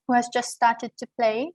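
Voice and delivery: ordinary talk, nothing marked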